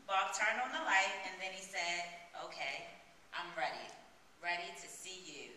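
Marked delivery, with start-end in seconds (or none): 2.4-5.5 lower, softer voice for the lover; hushed and deliberate, lightly acted; soft, intent. Bob tells the woman he is ready to see her, soft and intent.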